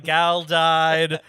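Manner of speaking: loud, drawn-out roll call; mock announcement. Reporting the dead when they get back, mock-announcing loudly. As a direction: call out each casualty loudly, as a joke. Imagines announcing the deaths afterwards.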